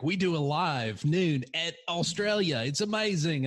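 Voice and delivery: high, slow Australian imitation; half-assed Australian accent. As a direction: do a half-hearted Aussie accent. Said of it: "That was just kind of a half-assed one".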